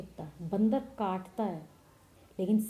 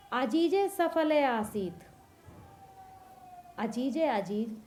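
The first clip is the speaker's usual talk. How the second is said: ordinary talk, nothing marked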